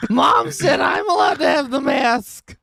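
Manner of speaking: put-on high, drawn-out childish whine; tattling kid. Friend mocks the mask thief, high bratty whine. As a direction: whine like a kid demanding a turn.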